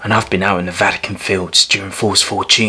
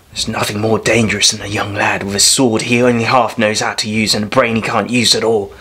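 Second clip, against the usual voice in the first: ordinary delivery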